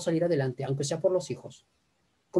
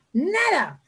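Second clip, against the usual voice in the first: mandato imitado; 11 semitones higher than usual. Retold or imitated. Parent throwing son in pool.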